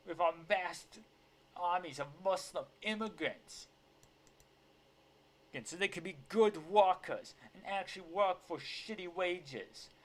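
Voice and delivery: loud, high theatrical tirade; video impression of outrage. Commentator parodying outraged politician about the border, mock indignation. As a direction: rant with hammed-up indignation, leaning hard on DARE. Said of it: "I'm gonna say it like that humongous video".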